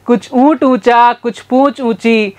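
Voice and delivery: careful deliberate tongue twister; slow tongue twister. Shown as a demonstration. Teacher slowly demonstrates the camel tongue twister. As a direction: lay out each word slowly. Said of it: "वापस से बोलेंगे".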